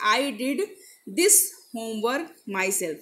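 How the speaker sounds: slow, deliberate dictation of the sentence; slow example read. Shown as a demonstration. Teacher to students, slow careful reading. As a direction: read each word out slowly for the class.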